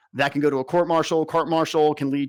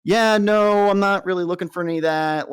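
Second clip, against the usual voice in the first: higher, louder start on a polite brush-off; polite refusal; 5 semitones higher, 4 dB louder than usual. Retold or imitated. Friend turning down two NCOs' advances, imitated polite refusal. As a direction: imitate someone declining politely, easing down after the opening. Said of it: "but she politely said".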